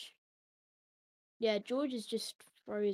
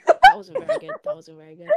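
squeaky laugh-cracked self-mockery; laughing at own bad impression; 17 dB louder than usual. Real, not acted. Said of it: "laughing at own attempt".

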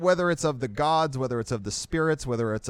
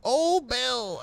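put-on very old man; 11 semitones higher than usual. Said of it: "I can't do it as well".

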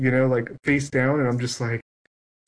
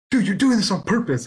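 mock outraged complaint with raised pitch; retold accusation; 7 semitones higher than usual. Opponent accuses player of mulliganing on purpose, indignant.

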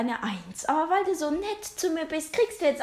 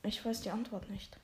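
much softer, mumbled answer; very quiet; 4 semitones lower, 14 dB quieter than usual. Acted. Shy pupil mumbling the answer to the teacher. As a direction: keep it tiny and soft. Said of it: "Wie bitte?".

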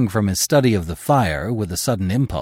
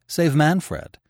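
ordinary delivery, nothing marked

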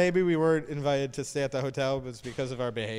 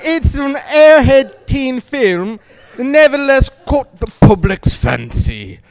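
slow, louder British nature-documentary voice; David Attenborough impression; 6 semitones higher, 14 dB louder, slower than usual. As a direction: narrate like a wildlife film. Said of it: "it's David Attenborough now".

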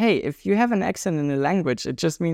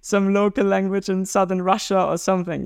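imitating the stranger; 5 semitones higher than usual. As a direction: play the stranger with a laugh in the middle. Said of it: "And they're like".

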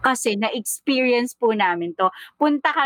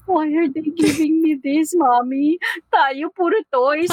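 put-on child crying; 6 semitones higher than usual. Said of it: "Sabi niya".

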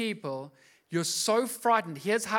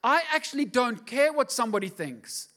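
loud, high and defiant; defiant realisation; 7 semitones higher, 5 dB louder than usual. Retold or imitated. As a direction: throw it off with bold defiance. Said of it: "you realize".

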